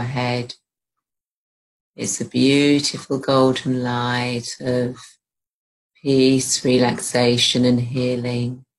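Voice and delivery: slow, even meditation delivery with long pauses; guided meditation. Real, not acted. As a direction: ease the listener into relaxation, phrase by phrase.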